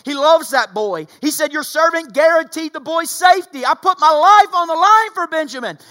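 loud, high, fervent sermon delivery; rising revival preacher cry; preaching at full force. Acted. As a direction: preach it hard to the whole room. Preacher voices Judah's plea.

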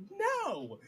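high, loud shouted no; mock outcry. Imagined Faile objecting to Perrin's sacrifice, high yell. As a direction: yelp it in protest.

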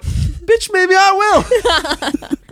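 high, slow, laughing defiance; defiant sass. Retold or imitated. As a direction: drawl it back with a laugh. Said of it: "And I'm like".

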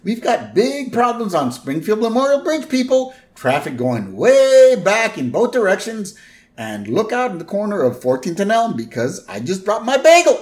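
high, loud traffic reporter voice with stretched words; hammed-up airborne traffic bulletin, pushed high; Arnie Pie. Helicopter reporter warning drivers of bridge jam, hammed-up high broadcast voice. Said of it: "we're with Arnie Pie".

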